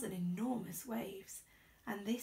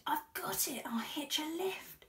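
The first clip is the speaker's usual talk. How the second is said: higher, eager whisper of a sudden idea; excited idea; 6 semitones higher than usual. A narrator's reading. Tiny snail hitting on a plan, excited whisper. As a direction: burst out with the plan. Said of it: "then cried".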